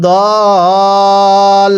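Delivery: দল with the vowel held four alif counts; long madd on dal. Shown as a demonstration. Teacher showing students দল with a four-count pull. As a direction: stretch the vowel of দল long and steady. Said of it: "দদের মধ্যে চার আলিফ টান দিব".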